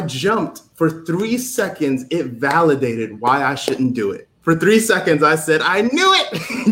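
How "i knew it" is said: high, stretched mock yell; retold yell. Jumper proven right as he falls, mock yelling. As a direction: imitate your own yell without full volume. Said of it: "You can hear the yell, the most guttural yell".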